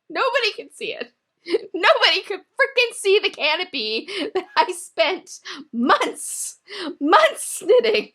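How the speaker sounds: high, laughing exasperation; laughing rant. Real, not acted. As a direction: laugh through the frustration, stretching out months. Laughing rant about hidden canopy.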